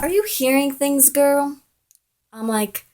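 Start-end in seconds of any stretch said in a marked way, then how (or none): 0.0-1.6 high, quick dismissive question; mock disbelief. Boy to jealous girl, acting incredulous. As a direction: imitate a boy brushing it off, pitched up.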